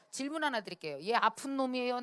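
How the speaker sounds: ordinary delivery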